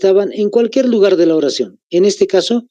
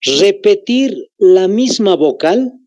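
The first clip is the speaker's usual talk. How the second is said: slow dictation; 3 semitones higher, slower than usual. Shown as a demonstration. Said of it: "Anótense".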